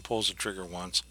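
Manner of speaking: usual speaking voice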